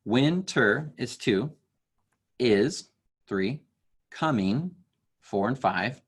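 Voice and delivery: slow counting of "Winter is coming" beat by beat; counting syllables. Shown as a demonstration. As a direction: tap out each syllable as you count aloud. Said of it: "count along with me".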